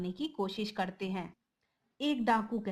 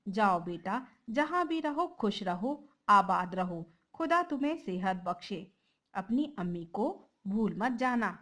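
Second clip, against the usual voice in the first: ordinary delivery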